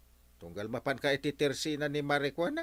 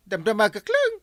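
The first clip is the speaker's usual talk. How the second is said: loud retort; 7 semitones higher, 10 dB louder than usual. Acted. Defensive reply in quarrel.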